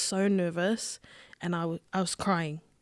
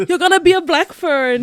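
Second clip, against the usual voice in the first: nana's voice; 10 semitones higher, 11 dB louder than usual. Retold or imitated. Nana voiced in the story.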